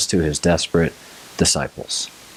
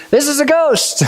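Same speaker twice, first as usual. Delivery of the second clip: high, hammed-up cry of fear; mock scared cry. Disciples seeing Jesus on the water, a high mock-scared cry. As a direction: play the panicked disciple. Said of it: "the only thing they come up with".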